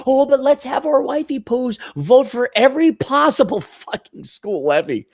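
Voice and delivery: lightly voiced high mockery; a hint of mock prissiness; mock suburban voice. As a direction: sneer at the school levy voters. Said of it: "mocking wives voting".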